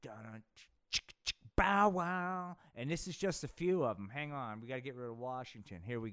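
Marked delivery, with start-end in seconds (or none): 0.0-2.6 beatboxed funk riff with chicka and bow sounds; mouth-made theme riff. Acted. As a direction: vocalize a cheesy porn-funk groove.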